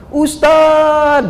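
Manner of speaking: high-pitched, drawn-out call; imitated distant call. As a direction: imitate a mother calling out to the ustadz. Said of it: "dari kejauhan panggilan begitu kencang". (menirukan panggilan kencang dari jauh)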